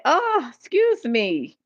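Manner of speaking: high, slow, drawn-out excuse me; scandalized, slowed-down excuse me; mock offended. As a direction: clutch your pearls at the kid. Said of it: "I said".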